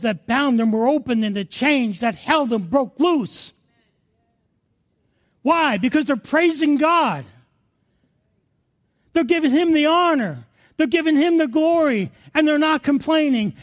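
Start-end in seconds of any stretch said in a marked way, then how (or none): 5.4-13.5 high, emphatic pulpit delivery; raised, rousing sermon voice; impassioned preaching. Real, not acted. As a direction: drive each point home to the congregation. Preaches at full force.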